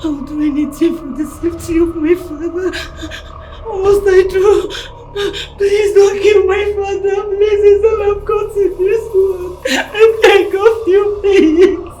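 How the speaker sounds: loud, sobbing plea; crying plea. Acted. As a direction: cry out begging for father. Begs kidnapper crying.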